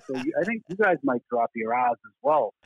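usual speaking voice

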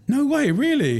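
finding out the customer really is Paul Smith, squealing disbelief; stunned disbelief. Retold or imitated. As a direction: shriek it like you can't believe it. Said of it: "And I went".

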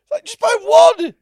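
high, loud exasperated yell; exasperated outburst. Real, not acted. Co-host heckles the imagined buyer of five, exasperated.

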